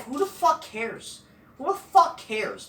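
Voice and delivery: high, drawn-out exasperated scorn; real exasperation, slow and high; scornful dismissal. Real, not acted. Scornful rant dismissing the wrestler's matches.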